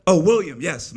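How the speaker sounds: loud, high burst of pretend realization; mock sudden recognition. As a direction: act as if you just deciphered your own name. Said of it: "It went something like this".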